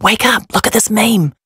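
ordinary delivery

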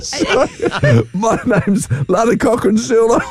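high, giggling self-mockery; laughing. Real, not acted. Host mocking himself as a detective, laughing. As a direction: laugh through the joke name.

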